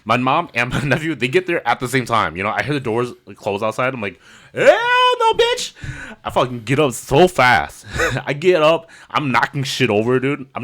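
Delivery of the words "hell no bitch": very high squealed refusal; mock squeal. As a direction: shriek and run. Bolting from house.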